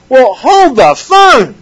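high, drawn-out mock astonishment; mock objection. Preacher stops at a verse he pretends to question, mock-astonished.

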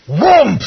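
loud, high imitated whoomph; explosive one-word sound effect; whoosh of sudden change. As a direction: punch out a sudden impact noise.

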